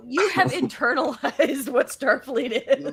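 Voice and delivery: high, loud, slow words through laughter; amused delight. Real, not acted. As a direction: laugh while praising them. Delighted by the other's catchphrase.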